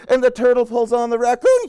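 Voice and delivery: high, loud, driving narration; excited climax. A narrator's reading. Chain of animals jolts, excited high narration.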